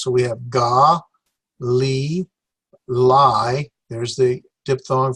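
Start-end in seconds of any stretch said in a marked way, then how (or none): 0.0-3.7 ga, li, li given as separate beats; syllables sounded out. Shown as a demonstration. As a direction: break ga li li into slow separate syllables. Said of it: "So that's four syllables".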